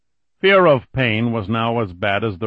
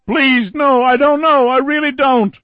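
high, loud desperate begging; terrified pleading; 8 dB louder than usual. Acted. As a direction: plead in sheer terror. Billy begging in terror.

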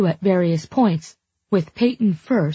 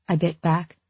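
ordinary delivery

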